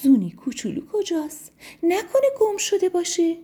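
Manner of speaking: put-on high, anxious mother calling for her child; a mother snail suddenly missing her baby; worried mother snail. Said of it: "کرد و گفت".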